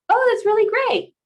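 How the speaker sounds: very high, loud token praise; token enthusiasm. Acted. Partner glancing at phone while replying, high-pitched token enthusiasm. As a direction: pitch it way up, an exaggerated polite reply. Distracted partner's acted response.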